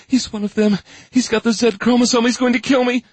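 high, panicked woman's voice; rising panic, near screaming; terrified. Acted. Robin terrified of intruder.

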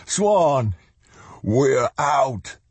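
high, elated, close to tears; joyful cry. A narrator's reading. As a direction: call out overjoyed. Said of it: "about to sob with joy".

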